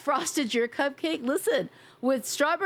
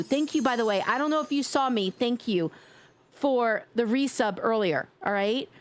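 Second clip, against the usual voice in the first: ordinary delivery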